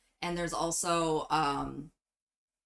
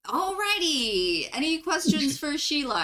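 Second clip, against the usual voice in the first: chirpy tour host; 7 semitones higher, 4 dB louder than usual. Acted. Tour host asks for questions for the changeling, high and chirpy. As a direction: move things along with put-on brightness.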